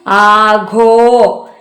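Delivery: ആഘോ stretched very long; a teacher drawing out ആഘോ; slow model answer. Shown as a demonstration. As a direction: hold ആഘോ out for the class. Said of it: "എന്താണ്?".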